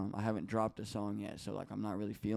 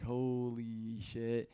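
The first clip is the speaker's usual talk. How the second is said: very drawn-out, awed swearing; retold amazement; much slower than usual. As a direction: stretch the words out in disbelief. Retells seeing the song take off.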